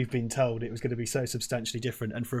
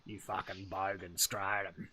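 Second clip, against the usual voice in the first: mock Australian insult; 4 semitones lower, 10 dB quieter, slower than usual. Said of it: "mock Aussie insult".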